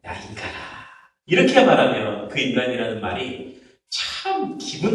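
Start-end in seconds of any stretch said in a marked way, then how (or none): 0.0-0.6 very soft, sighed address; deep sigh. Acted. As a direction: breathe the words out in a sigh. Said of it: "한숨 쉬면서".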